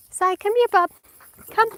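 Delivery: high, loud call to a pet; calling the dog. Real, not acted. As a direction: call your dog back to you.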